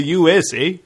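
"U S A" ending like a Canadian "eh"; Canadian pronunciation. Shown as a demonstration. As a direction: say "U S A" as a joke Canadian "U S, eh?". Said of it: "Canadian pronunciation".

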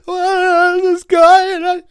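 high, loud mock sobbing; hammed-up crying. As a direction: wail like a jilted friend.

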